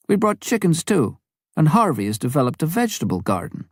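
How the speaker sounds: Lavina's voice. Acted. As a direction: voice the missionary wife answering calmly, pitched well up.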